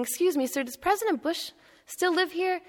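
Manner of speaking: lightly voiced old gentleman, politely unsure; timid old man. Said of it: "comes back the next day and says the same thing".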